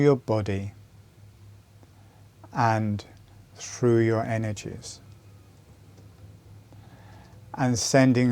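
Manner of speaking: calm, unhurried meditation guide with long pauses; measured meditation voice, letting each phrase settle; guided meditation. Real, not acted. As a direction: guide them inward phrase by phrase, leaving space between.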